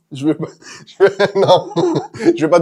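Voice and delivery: talking through giggles; laughing. Real, not acted. Streamer amused by their own English accent, laughing. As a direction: break up laughing between the words.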